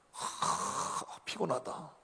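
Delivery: weary sigh into a short tired line; mock tired disciple, sighing; acted weariness. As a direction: breathe out a long sigh, worn out.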